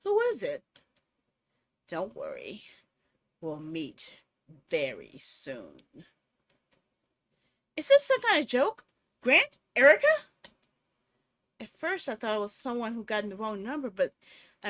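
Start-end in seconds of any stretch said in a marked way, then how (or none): 1.9-5.8 very low, slow, hoarse menace; deep creepy stalker voice; gruff menacing caller. Acted. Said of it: "a hoarse, gruff voice". 7.8-10.1 high, loud panicked questions; frantic raised voice; alarmed shouting. Acted. Frightened caller demanding answers.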